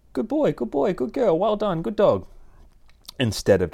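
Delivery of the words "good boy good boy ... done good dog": much higher, bright praise voice for the dog; praising the dog. Shown as a demonstration. Trainer praising a dog for good behaviour, high happy praise. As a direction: cheer the dog on, sing-song and high. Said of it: "later on you can then be going".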